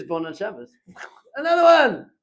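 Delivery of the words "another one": mock cheer. Father jokingly cheers Shabbos drivers to his kids, loud mock celebration. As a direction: cheer at the passing car like it's great news.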